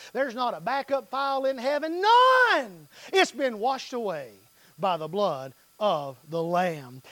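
usual speaking voice

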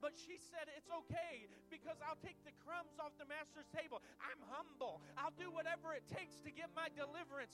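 loud, high, impassioned preaching; preaching at full force. Acted. Pastor rousing the congregation, loud fervent preaching. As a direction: whoop it up like a revival preacher.

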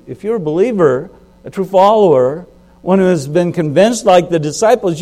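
rising preacher fervor. Real, not acted. Preacher builds to insistence.

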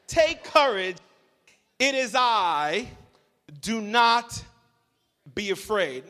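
preaching fervor. Acted. Preacher proclaiming Jesus' comfort to the church. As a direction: proclaim it with conviction.

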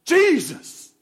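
sudden loud high cry of Jesus!; a man at the register yelling the name; shouted exclamation. Retold or imitated. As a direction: blurt it out across the store like a yell. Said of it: "he goes".

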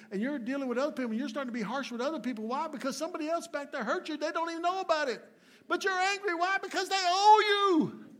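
high, fast build to a full shout; impassioned preaching. Real, not acted. Preacher challenging congregation over their anger, impassioned shouting. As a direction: climb in pitch and volume to a shouted climax.

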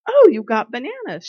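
loud, delighted "oh, you got bananas"; retelling her excited surprise at the checkout; excited exclamation. Retold or imitated. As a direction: call out with sudden delight. Spots bananas in checkout line.

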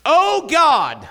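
desperate loud cry to God; cries out. Acted. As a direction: shout it out as he falls. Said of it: "he cries out".

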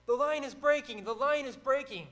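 loud, high battlefield yell; yelling a warning. Retold or imitated. Commander yells to Ben across the battle, loud and high. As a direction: shout the alarm across the field. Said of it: "he yells at him".

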